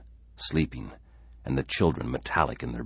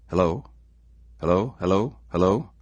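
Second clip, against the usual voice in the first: raised, louder questioning hellos; calling down phone; 6 semitones higher, 6 dB louder than usual. Acted. Simpson to silent caller on phone, calling questioningly. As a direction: keep calling into an unanswering line.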